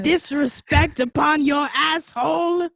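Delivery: loud, slow, mock-indignant bellow; mock outraged shout. Cohost mocking the date's indignation, a loud slow shout. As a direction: boom it out in hammed-up outrage. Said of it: "you like".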